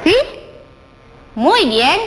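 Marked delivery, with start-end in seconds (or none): none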